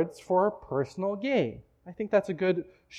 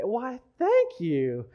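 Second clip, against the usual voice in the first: higher, very drawn-out thanks; flattered teacher; 4 semitones higher, much slower than usual. Retold or imitated. Older teacher thanking the flattering athlete, hammed-up flattered tone. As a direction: play the flattered teacher, stretching each word.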